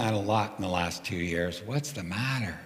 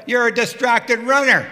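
loud, much higher shout; yelling back; 11 semitones higher, 10 dB louder than usual. Retold or imitated. Cyclist shouting back at the runner with earplugs, loud. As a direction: shout it back at her retort-style. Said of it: "So I yell back at her".

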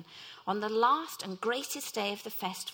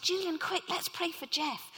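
urgent call; 6 semitones higher than usual. Retold or imitated. Calling out to friend.

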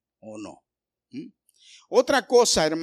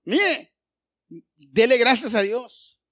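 preaching loudly; 6 semitones higher, 7 dB louder, slower than usual. Real, not acted. Pastor exhorts congregation.

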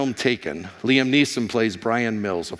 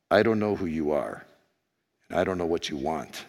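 Liam Neeson; 6 semitones lower, 5 dB quieter than usual. Retold or imitated. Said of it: "this sounds better with my hoarse voice".

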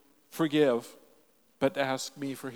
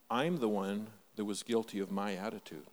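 low, soft admission of guilt; sincere confession; 6 semitones lower, 8 dB quieter than usual. Real, not acted. Preacher admits his own guilt to the congregation, soft and sincere. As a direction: drop down and own it quietly. Confession after the victim protest.

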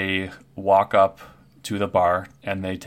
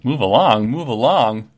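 parroted words, much louder; loud mimicking echo; 3 semitones higher, 9 dB louder than usual. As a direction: squawk his words back at him. Kenku echoes the barkeep's words.

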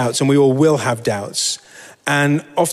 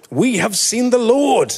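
excited disciples; 10 semitones higher than usual. Acted. Disciples' excited report to Thomas.

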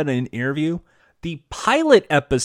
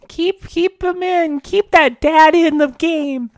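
high-pitched mock viewer; 13 semitones higher, 5 dB louder, slower than usual. Mock viewer vote, joining bit.

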